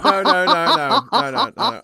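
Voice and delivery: loud, high refusal through laughter; laughing protest. Real, not acted. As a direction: protest while cracking up.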